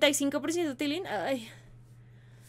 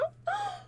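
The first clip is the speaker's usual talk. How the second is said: sudden high gasp of shock; high sharp gasp. Real, not acted. Streamer realizing she may be the killer, high shocked gasp. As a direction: gasp as if caught.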